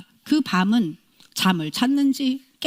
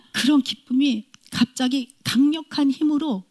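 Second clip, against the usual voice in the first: ordinary delivery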